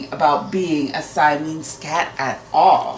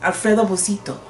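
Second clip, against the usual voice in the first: plain reading voice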